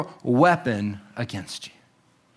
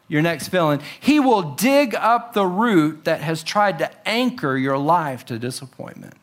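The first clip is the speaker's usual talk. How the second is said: ordinary talk, nothing marked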